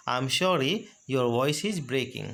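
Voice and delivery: slow and deliberate; slow model phrase. Shown as a demonstration. Teacher repeating the voice-breaking phrase for students, slow. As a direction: stretch each word for the class. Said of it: "breaking word का use कर सकते हैं".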